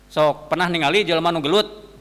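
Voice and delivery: normal talking voice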